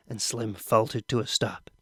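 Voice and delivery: usual speaking voice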